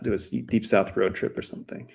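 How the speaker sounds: usual speaking voice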